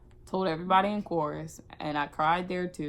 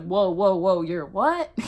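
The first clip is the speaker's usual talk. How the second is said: shocked; 7 dB louder, slower than usual. Retold or imitated. Coach shocked by news.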